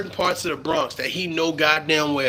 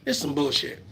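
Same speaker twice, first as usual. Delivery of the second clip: usual speaking voice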